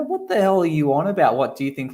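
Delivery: high-pitched incredulous retort; retold indignation. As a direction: protest in mock outrage, pitch jumping up.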